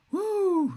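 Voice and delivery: loud, very high-pitched woo; high whoop. Real, not acted. Streamer recovering from a laughing fit, high whoop. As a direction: let out a piercing whoop.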